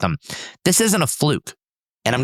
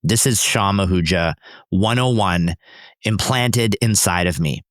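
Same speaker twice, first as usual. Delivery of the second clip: normal talking voice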